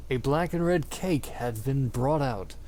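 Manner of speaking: ordinary talk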